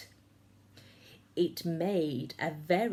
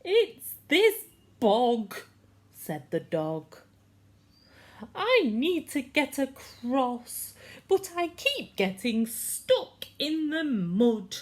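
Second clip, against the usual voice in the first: howling dog; 7 semitones higher, 5 dB louder than usual. A narrator's reading. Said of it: "a dog who was howling".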